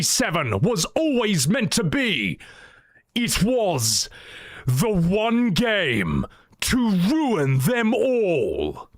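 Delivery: grave, slow fantasy prologue voice; epic fantasy narrator. Acted. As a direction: tell it like an ancient legend. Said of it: "think of the opening of Lord of the Rings".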